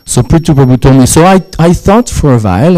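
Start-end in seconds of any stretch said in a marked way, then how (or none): none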